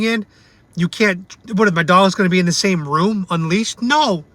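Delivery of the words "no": normal talking voice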